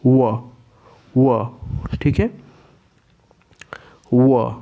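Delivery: touch teeth to lip for व; demonstrating व. Shown as a demonstration. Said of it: "दांत और ओष्ठ दोनों के सहयोग से". (दंतोष्ठ्य व)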